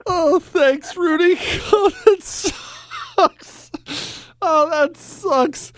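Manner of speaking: high-pitched words through laughter; real amused laughter, slowing at the end; cracking up. Real, not acted. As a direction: laugh helplessly while sympathizing. Host cracks up at caller's story.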